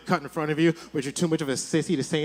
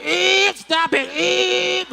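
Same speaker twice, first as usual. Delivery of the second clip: very high, louder gagging yell; choked mock scream; 12 semitones higher, 4 dB louder, much slower than usual. Finger down his throat, high mock choked scream. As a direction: scream through a mouthful in mock panic. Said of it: "eight inches deep going".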